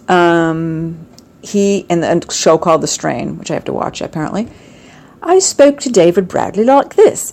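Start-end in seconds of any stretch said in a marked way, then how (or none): 5.2-7.3 light put-on British accent, pitched higher; a hint of a British accent, lifted and bright with charm. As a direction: slip into a polite British accent, a little up. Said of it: "in my British accent".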